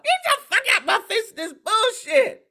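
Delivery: high, loud, far-off snap; angry daughter yelling at mom, high and loud; mock yell. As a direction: throw her out of your face.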